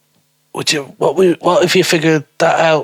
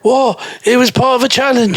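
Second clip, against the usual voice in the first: mock defensive girl; 10 semitones higher than usual. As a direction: brush it off in a squeaky voice. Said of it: "She goes".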